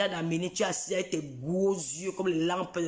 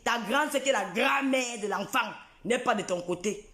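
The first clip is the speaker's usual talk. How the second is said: usual speaking voice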